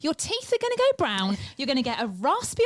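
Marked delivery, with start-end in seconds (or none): 0.0-1.4 high-pitched horrified warning; shrill mock alarm. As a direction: squeal it in mock horror. Repeats warning, far higher.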